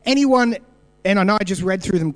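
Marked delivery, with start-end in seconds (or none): 0.0-0.5 louder, higher call to the room; preacher inviting the congregation to answer, raised voice. Real, not acted. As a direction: throw the question out to the crowd.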